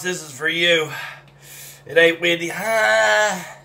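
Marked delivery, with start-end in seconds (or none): 2.5-3.6 high, sustained "ah"; long high exhale of pain; burning-mouth cry. Real, not acted. Reacting to hot pepper.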